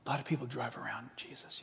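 unvoiced whispered aside; hushed whispered aside. Real, not acted. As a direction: confide it quietly. Aside punchline.

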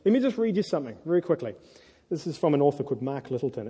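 usual speaking voice

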